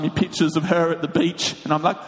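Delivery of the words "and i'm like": ordinary delivery, nothing marked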